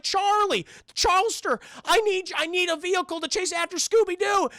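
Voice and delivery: high, urgent cartoon slacker; Shaggy. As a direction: plead in a high goofy sidekick voice. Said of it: "He's like".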